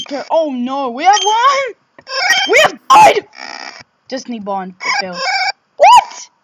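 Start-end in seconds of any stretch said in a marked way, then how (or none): 0.9-3.3 high, loud outcry building to a shriek; real alarmed yelp; shocked disbelief. Real, not acted. Reacting to losing in game. 5.8-6.3 very high, loud squawk; real incredulous yelp; shocked disbelief. Real, not acted. Disbelief at game event.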